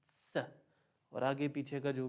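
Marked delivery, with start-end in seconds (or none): 0.1-0.4 स said as "tsa"; a short स with a t tapped in front; German Z sound. Shown as a demonstration. Teacher telling students how to say every Z, ts sound. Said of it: "उसको हमें बोलना है".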